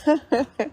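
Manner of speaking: short burst of real laughter. Real, not acted. Speaker cut off by a challenger, high short laugh. Laughs during illiteracy dispute.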